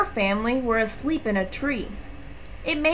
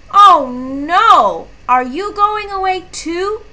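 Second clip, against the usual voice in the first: higher, louder cry of dismay at more friends leaving; a sudden high "oh no" that falls into a pleading question; Moosling realising Skunk leaves too, surprised and higher; dismayed surprise; 8 semitones higher, 8 dB louder than usual. A narrator's reading.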